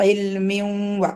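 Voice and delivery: ഇൽമിൻ വ very slow, the n hummed into the വ; merged nasal. Shown as a demonstration. Teacher showing the nasal merge to students. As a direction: hum the n into വ slowly. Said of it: "വാവിൽ വെച്ചിട്ടാണ് മണിക്കുക".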